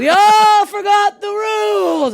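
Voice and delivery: very high, drawn-out bellow; hammed-up scolding shout; yelling. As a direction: holler it slowly at a whole plane. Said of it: "I'm always yelling on flights".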